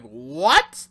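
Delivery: loud, rising 'what?' of disbelief; shocked double take. Retold or imitated. Retelling own reaction to the new names, stunned.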